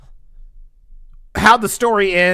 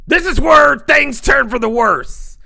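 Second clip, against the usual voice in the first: high, loud, slow dramatic reveal; dramatic announcement; 10 semitones higher, 6 dB louder than usual. Real, not acted. Storyteller revealing where things go wrong, dramatic. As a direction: draw it out loudly for suspense.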